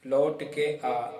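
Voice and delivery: slow spoken phrase; slow demo. Shown as a demonstration. Teacher showing the line slowly to students. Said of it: "ये देखो".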